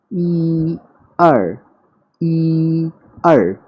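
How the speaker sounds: "ई" long, high and level, "आर" falling sharply; Mandarin tones like music. Shown as a demonstration. Teacher singing counting tones so students remember them, slow musical tones. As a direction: chant "ई आर" slowly, like a tune. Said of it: "it's like a music".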